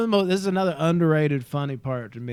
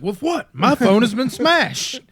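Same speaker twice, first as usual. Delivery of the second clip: mock indignation; 8 semitones higher, 6 dB louder, slower than usual. Quoting indignant reply.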